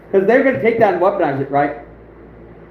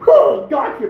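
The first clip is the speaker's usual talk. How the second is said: higher-pitched mock triumph; playful gotcha; 5 semitones higher, slower than usual. Catching out a sneaky rival, playful triumphant taunt. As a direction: pounce on it teasingly.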